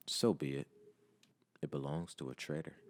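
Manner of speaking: very low, soft menace; icy, quiet villain; cold dismissal. A narrator's reading. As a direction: overrule a subordinate without caring. Zhao coldly overrules the soldier.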